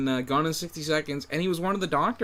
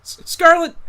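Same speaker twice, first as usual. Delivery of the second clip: excited shout; 13 semitones higher, 10 dB louder than usual. Real, not acted. Blurting the character's name.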